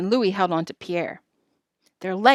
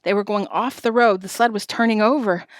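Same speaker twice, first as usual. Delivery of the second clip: ordinary delivery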